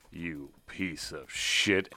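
slow, low contempt; contemptuous. Acted. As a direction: spit the insult out slowly. Leader insulting Geralt before hitting him.

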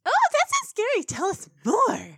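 high, loud, eager squeal; thrilled pony. Retold or imitated. Pony egging granny on, loudly delighted. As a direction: beg for the scary story with delight. Acts out Pinkie thrilled by tale.

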